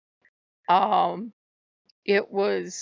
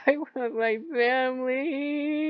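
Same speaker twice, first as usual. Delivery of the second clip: higher, slow, drawn-out mock whine; plaintive wail; 5 semitones higher, slower than usual. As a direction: wail it slowly like a homesick child. Retells own wailing for family.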